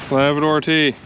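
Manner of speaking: ordinary talk, nothing marked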